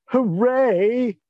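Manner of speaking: high mock cheer, sarcastic; sarcastic hooray, drawn out. Shown as a demonstration. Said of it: "if I may".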